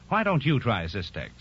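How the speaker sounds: usual speaking voice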